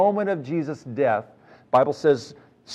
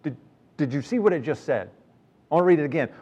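ordinary delivery

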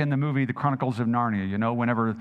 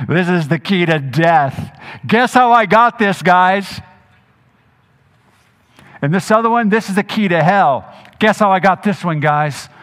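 louder, teasing risen Jesus showing off the keys; playful Jesus; 5 semitones higher, 10 dB louder than usual. Acted. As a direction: hold up the keys and tease the guys. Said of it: "He says".

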